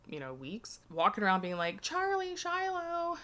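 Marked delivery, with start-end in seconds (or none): 1.8-3.2 high calling out of names. Retold or imitated. Eve searching the dark house, calling out high. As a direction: call them through the empty house.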